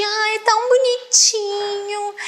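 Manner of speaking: high, mock-sweet pitying coo; condescending cooing. Imitating people who patronise sign language, condescending coo. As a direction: coo it as if at something small. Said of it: "coloca uma entonação de".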